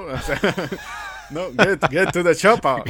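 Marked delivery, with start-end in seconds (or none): 1.6-2.9 put-on loud, slow Austrian-accented bark as Arnold; hammed-up Arnold action-movie Austrian accent; booming Austrian accent, words stretched out; Austrian accent shout. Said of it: "con su buen acento austriaco".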